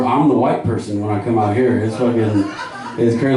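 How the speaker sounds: usual speaking voice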